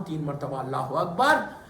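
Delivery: ordinary talk, nothing marked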